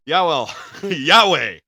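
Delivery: Yahweh laughed out loud; laughing Yahweh. Acted. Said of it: "Yahweh instead of anyway".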